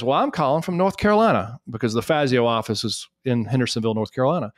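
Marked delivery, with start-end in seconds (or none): 0.0-1.6 Southern assistant. Retold or imitated. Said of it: "And she says".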